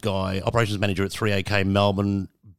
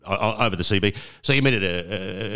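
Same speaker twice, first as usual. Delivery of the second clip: ordinary delivery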